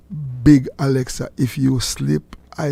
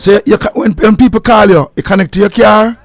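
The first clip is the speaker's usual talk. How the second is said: loud; 6 semitones higher, 7 dB louder than usual. Real, not acted. Host insisting on car instructions.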